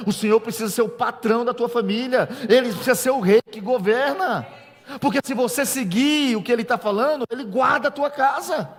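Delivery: loud, high, fiery preaching; preaching at full force. Acted. Pastor preaching to the congregation, loud and fiery. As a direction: thunder the verse out to the church.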